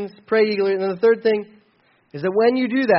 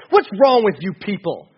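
louder, harsh accusing preacher; scolding rant; 6 dB louder than usual. Retold or imitated. Preacher mocking a condemning churchgoer, loud scolding. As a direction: berate the crowd like a finger-wagging judge. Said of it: "It's not like".

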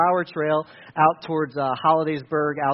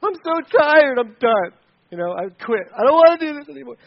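whiny quitter; 7 semitones higher, 6 dB louder than usual. Retold or imitated. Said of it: "I'd be like".

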